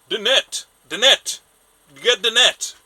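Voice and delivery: loud, high-pitched calls for help; urgent shouting. Acted. Old fisherman calling to a passer-by for the net, urgent shouting. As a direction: shout it urgently across the water. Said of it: "and he just said".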